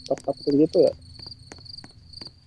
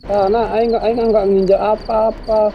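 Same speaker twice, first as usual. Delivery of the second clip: panicked protest; 7 semitones higher, 4 dB louder than usual. Real, not acted. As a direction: plead innocence while shooing it away. Protesting while shooing the ghost. (memohon panik dengan nada tinggi)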